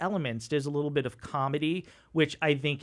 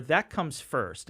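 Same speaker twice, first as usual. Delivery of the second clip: usual speaking voice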